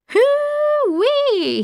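very high, long whoop; high swooping cry of exhilaration; exhilarated whoop. Acted. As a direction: let out a thrilled, high-pitched holler. Royal exhilarated after wild ride.

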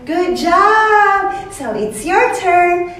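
high, bright cheering; kids show host. Acted. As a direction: cheer the kid on.

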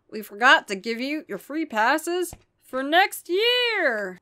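slow, loud monster voice. Acted. The horror offering the family passes, monster voice. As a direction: hand over the passes like a creepy horror.

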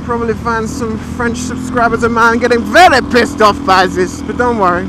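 hammed-up French accent. Vlogger answering imagined critics, put-on French accent. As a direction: play a mock Frenchman, pitch lifting with the boasting. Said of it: "it's kinda French".

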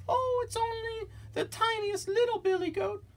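tiny squeaky falsetto goat; very high little goat voice; very small voice. Acted. Smallest goat answering the troll, very small high voice. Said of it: "in his very small voice".

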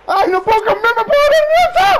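high, loud panicked cry; panicking gamer. Real, not acted. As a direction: panic as the screen goes blind. Bajo fuego, no ve nada. (gamer en pánico)

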